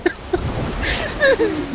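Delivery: breathless high laughter. Real, not acted. Laughing at sneezes.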